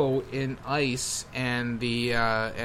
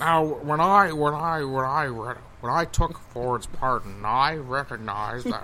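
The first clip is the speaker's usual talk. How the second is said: slow, halting old-politician impression; Richard Nixon; slower than usual. Co-host mimics old TV footage of a president, slow impression. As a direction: drag it out like a president on archival footage. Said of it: "Did we just play archival footage?".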